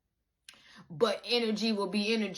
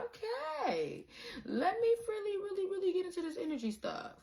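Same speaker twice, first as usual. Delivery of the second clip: high, breathless hammed-up eagerness; high silly keen voice; mock eager student; 11 semitones higher, 5 dB quieter than usual. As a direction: ham up a keen beginner diving in. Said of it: "now you're like".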